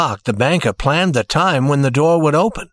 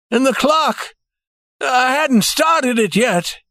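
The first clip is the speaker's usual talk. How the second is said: put-on old banker's voice, higher and alarmed; 11 semitones higher, slower than usual. A narrator's reading. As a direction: cry out that the door is stuck. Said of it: "he cried".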